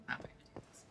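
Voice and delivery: hushed whisper under her breath; a private vow breathed out; whispered to herself. Retold or imitated. To herself while working up the strength, whispering.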